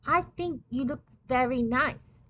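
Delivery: put-on gentle French accent, slowing at the end; Pierre Boulanger. A narrator's reading. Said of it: "had said with his French accent".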